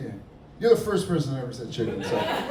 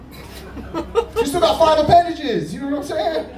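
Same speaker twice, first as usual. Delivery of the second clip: higher and louder, slow and laughing; excited; 14 semitones higher, 7 dB louder, slower than usual. Real, not acted. Comic pitching his octopus joke to the crowd, giddy and loud. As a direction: sell the punchline, giddy. Punchline pushed high.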